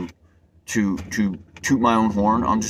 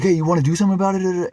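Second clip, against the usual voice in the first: higher and quicker, picking a fight; mock aggressive challenge; 8 semitones higher, faster than usual. Man at the meeting daring him to fight, mock aggressive. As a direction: imitate the guy squaring up, pushy and fast. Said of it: "and he's like".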